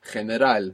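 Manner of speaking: General said with throat friction, heh-ne-RAL; Spanish j sound. Shown as a demonstration. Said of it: "causing a bit of friction in the throat".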